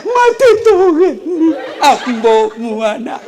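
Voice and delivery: high, slow hammed-up wail; mock wailing widow. Preacher mocking a widow wailing over her husband, high slow mock wail. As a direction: ham up a grieving widow's lament. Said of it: "ada orang meninggal suaminya".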